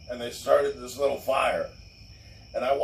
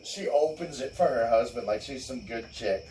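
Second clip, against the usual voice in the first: ordinary delivery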